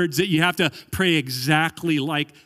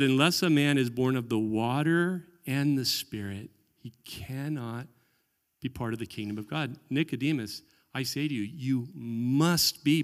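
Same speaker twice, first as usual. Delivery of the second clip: hushed Jesus quote; 4 semitones lower, 6 dB quieter than usual. Retold or imitated. Jesus quoted, voice dropping low.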